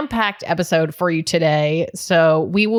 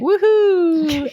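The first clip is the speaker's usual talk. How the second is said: high-pitched elfin cheer; Keebler elf; 10 semitones higher than usual. Pretend. Podcast host cheering after the sponsor intro, high elf voice. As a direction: pipe up with a drawn-out little elf whoop. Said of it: "sound like the Keebler elf".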